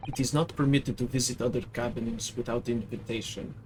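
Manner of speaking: put-on broken English in a heavy Russian accent; proud Russian crewman correcting the girl; gruff Russian sailor. Sailor to the maid, Russian accent, offended.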